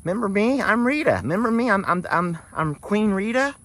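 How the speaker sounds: very high, eager voice; voicing Rita. Owner voicing Rita greeting the donkeys, very high and eager. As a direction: gush like a needy queen greeting old friends. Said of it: "She's like".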